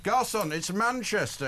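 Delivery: high, slow public correction; correcting a colleague. Real, not acted. Host tells producer it's Manchester, correcting. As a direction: stretch it out to set the producer straight.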